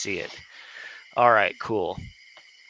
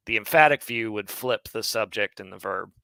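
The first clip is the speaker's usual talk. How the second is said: ordinary talk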